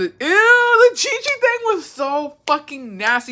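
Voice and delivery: high-pitched, grossed-out squeal; disgusted outburst. Real, not acted. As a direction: recoil in disgust, pitch shooting up. Disgusted outburst about gross thing.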